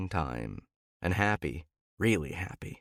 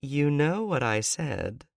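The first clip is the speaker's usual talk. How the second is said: higher woman's voice, slow and smiling; a smile in her voice; 8 semitones higher, slower than usual. A narrator's reading. She teases him on the phone, smiling. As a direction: let the smile through, teasing. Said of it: "There's a smile in her voice".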